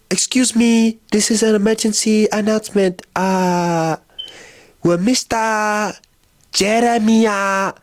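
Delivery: high, halting imitation of an airport announcer; intercom announcer. Announcer paging a passenger whose name she can't pronounce, high imitation. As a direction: draw out each name, high and unsure. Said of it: "she could not pronounce my last name".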